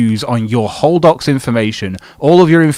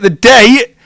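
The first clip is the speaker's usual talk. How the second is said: exasperated outburst; 11 semitones higher, 8 dB louder than usual. Real, not acted. Ranting at streamer's date mistake.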